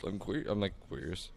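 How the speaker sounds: hiccup breaking the words. Real, not acted. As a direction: speak through hiccups. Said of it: "I'm hiccuping when I said it".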